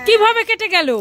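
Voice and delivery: high, worried demand; alarmed question. Acted. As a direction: ask urgently how it happened. Mother alarmed by child's injury.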